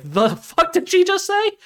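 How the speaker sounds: high, slow, incredulous put-on voice; high mock disbelief; stunned disbelief. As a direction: act floored by what you heard. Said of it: "you're just like".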